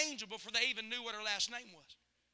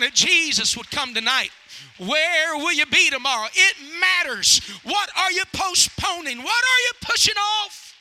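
full-force preaching; 2 semitones higher, 13 dB louder than usual. Real, not acted. Preacher urges congregation to stop postponing, full-force preaching.

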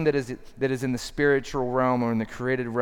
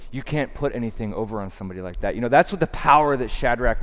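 ordinary talk

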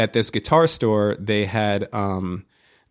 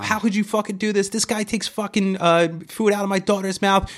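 high-pitched hammed-up screaming; imitating a hysterical rant, pitched way up; mock scream; 11 semitones higher than usual. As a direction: wail it high like she's losing her mind.

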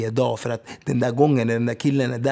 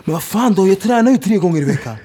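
imitating his son's frustrated outburst, higher and louder; whiny protest, pitched up and loud; frustrated kid; 7 semitones higher, 6 dB louder than usual. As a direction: son complaining he already trains three times a week. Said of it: "han blir frustrerad".

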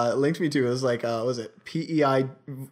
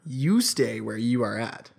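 slow, deliberate restatement; slow explaining; slower than usual. Shown as a demonstration. Explaining to a confused co-host, slow and deliberate. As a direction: spell it out word by word.

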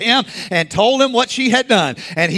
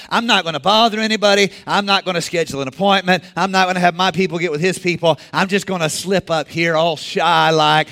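rattled off quickly; fast scheming inner voice. Acted. Preacher voicing a sneaky churchgoer, quick scheming mutter. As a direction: list the plan at speed, half-proud.

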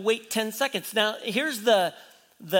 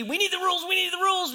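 high-pitched mimicked clamor; mock clamoring; 10 semitones higher, much faster than usual. Preacher mimicking people who all agree on rules, mock high clamor. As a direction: chant it high, like an eager crowd.

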